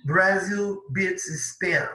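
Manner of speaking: Brazil beats Spain read very slowly; slow reading aloud. Shown as a demonstration. Teacher reading an English headline slowly to the class. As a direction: spell out the headline for learners. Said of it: "teacher reads headline slowly".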